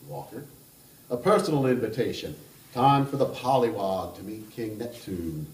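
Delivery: put-on a charming Kentucky gentleman teasing the table, Kentucky accent; playful Southern Kentucky voice, loud start. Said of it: "I'm going to attempt that kind of accent".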